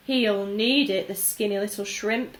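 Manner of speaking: ordinary delivery, nothing marked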